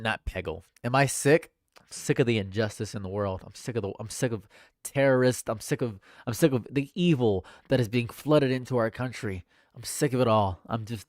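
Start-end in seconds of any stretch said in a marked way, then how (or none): none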